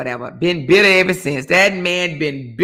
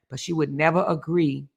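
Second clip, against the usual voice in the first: ordinary talk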